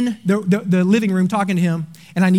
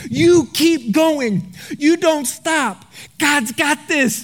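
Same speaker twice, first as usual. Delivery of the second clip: slow prophetic charge; 5 semitones higher, much slower than usual. Retold or imitated. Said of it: "She looked at Stephen and she said".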